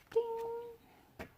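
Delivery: ordinary delivery, nothing marked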